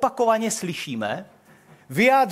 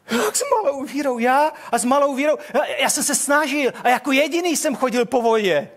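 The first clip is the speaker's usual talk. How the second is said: indignant protest; 6 semitones higher than usual. Acted. Peter protests being called faithless.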